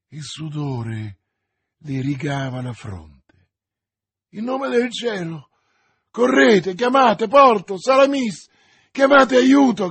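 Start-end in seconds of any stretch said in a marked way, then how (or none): 4.3-9.9 high, very loud urgent orders; desperate shout. A narrator's reading. D'Artagnan sending friends for help, desperate shout. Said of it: "gridò D'Artagnan".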